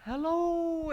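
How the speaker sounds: very high, loud, drawn-out squeal; imitated joyful greeting. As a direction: mimic a thrilled girlfriend greeting her boyfriend. Acted girlfriend's joyful greeting.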